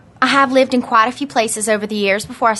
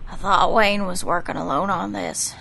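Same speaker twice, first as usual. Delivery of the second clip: weak and quiet; 3 semitones lower, 6 dB quieter than usual. Acted. Weak injured woman.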